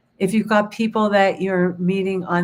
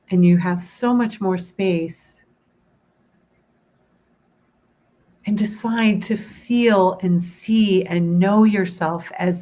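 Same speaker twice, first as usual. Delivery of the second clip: guided meditation. Acted. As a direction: guide them gently into calm.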